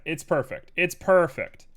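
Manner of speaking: excited. Real, not acted. Excited idea, louder repeat.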